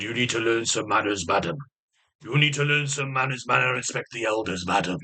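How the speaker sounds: low, pompous telling-off; mock-grand, deep-pitched reprimand; Oliver Reed. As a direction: scold like a haughty actor, dropping low on madam. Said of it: "I go to Oliver Reed".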